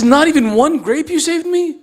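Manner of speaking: high, indignant disbelief; incredulous husband. Acted. Husband shocked no grape saved.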